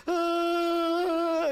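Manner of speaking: put-on high, loud little-girl squeal; child's shriek. Granddaughter rushing down the slide, high squeal. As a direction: squeal like a kid flying down a slide.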